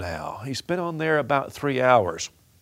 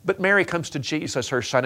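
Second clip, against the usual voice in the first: ordinary talk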